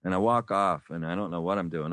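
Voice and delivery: normal talking voice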